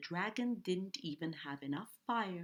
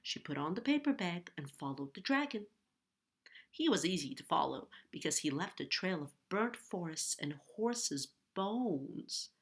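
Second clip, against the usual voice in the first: usual speaking voice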